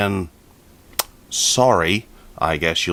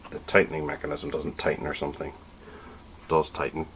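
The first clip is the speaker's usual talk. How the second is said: whispering; 4 semitones lower, 10 dB quieter than usual. Real, not acted. Muttered aside to himself.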